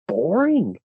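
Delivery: ordinary delivery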